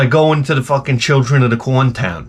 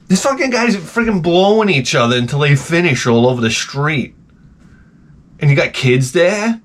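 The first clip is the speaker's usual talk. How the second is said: usual speaking voice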